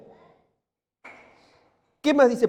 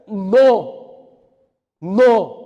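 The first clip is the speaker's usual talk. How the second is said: emphatic no; 8 dB louder than usual. Real, not acted. Preacher emphatic denial.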